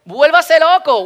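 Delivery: loud, high, drawn-out exhortation; slow, full-voiced holler; rousing shout. Real, not acted. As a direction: urge the crowd to let loose. Urging congregation to shout wildly.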